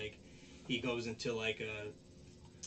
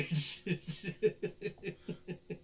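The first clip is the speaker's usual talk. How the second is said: helpless giggles mid-story; real giggling laugh; 8 semitones higher, 3 dB louder than usual. Real, not acted. Storyteller laughing at the butt bump, real giggling. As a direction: crack up at your own story. Cracking up at own retelling.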